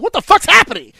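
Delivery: high, loud what the fuck; disbelieving shout. Retold or imitated. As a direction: yell it in disbelief at the TV. Retold disbelief, bigger.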